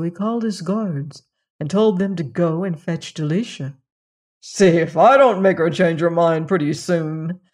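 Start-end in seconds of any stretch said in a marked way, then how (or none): 4.4-7.4 lightly voiced scheming king; a hint of wicked amusement; chuckling wicked king. A narrator's reading. Wicked king boasting about the princess, mildly chuckling. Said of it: "said the wicked king with a chuckle".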